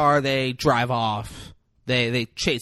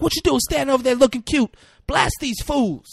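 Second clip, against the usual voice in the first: much higher, loud yell, slowing at the end; yelling at the guys; 11 semitones higher, 5 dB louder than usual. Retold or imitated. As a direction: order them to shoot from across the corner. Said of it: "she yells at the guys".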